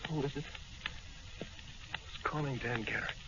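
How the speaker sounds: soft, slow, faint voice from the sickbed; weak and dazed. Acted. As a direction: barely rouse from near death. Weak patient woken at night.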